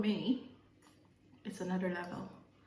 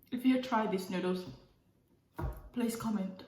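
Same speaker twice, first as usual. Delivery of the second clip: normal talking voice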